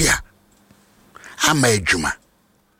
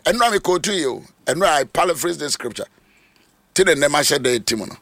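ordinary delivery

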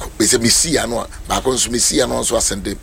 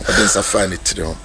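normal talking voice

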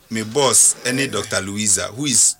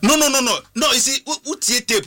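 excited protest; 13 semitones higher than usual. Real, not acted. Protesting a host's remark.

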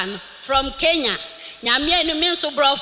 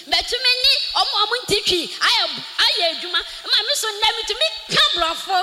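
higher, building to an excited shout; rising joyful testimony; 6 semitones higher than usual. Real, not acted. Church member testifying to the congregation, excited praise. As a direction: lift it up in praise.

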